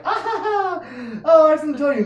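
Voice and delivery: put-on high, loud giggling child voice; joyful child laughing as he confesses; giggly child. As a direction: giggle up high like a delighted kid. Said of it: "joyous children laughter like".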